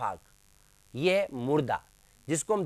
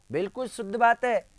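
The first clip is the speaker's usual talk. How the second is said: ordinary delivery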